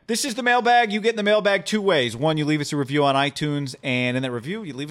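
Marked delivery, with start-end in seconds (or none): 0.1-2.2 mailbag intro. Acted. Announcing the mailbag segment.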